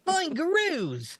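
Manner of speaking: hammed-up Aussie accent, stretched way out; very high, very slow mock Australian accent. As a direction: pitch it way up in a fake Australian accent. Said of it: "working on my Boston accent".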